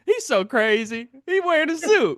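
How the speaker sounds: put-on high, giddy voice of an old lady staring at a stranger; mock gawking seatmate. Mimicking his seatmate gawking at a man in a suit, high and giddy. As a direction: squeal it out like she can't believe her eyes.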